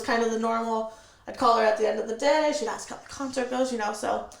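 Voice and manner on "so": normal talking voice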